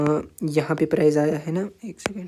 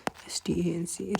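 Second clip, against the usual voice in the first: whispered spelling; 10 dB quieter than usual. Shown as a demonstration. Teacher spelling the word for students, slow whisper. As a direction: slowly whisper the letters ایس ٹی اے این سی one by one. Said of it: "resistance.".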